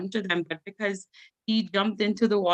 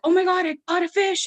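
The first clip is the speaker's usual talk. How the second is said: much higher, excited shout of a catch; uncle freaking out; 11 semitones higher, 4 dB louder than usual. Retold or imitated. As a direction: yelp like you just hooked a big one. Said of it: "Then he freaks out".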